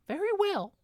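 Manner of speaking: the mouse queen granting the request; agreeing mouse queen. A narrator's reading. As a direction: give your royal consent in a squeak.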